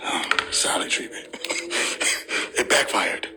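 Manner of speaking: soft breathless whisper; whispered aside. Real, not acted. Streamer whispering about wife's silence, hushed. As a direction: whisper it so she won't hear.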